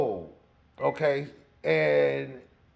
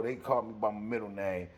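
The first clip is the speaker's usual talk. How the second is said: usual speaking voice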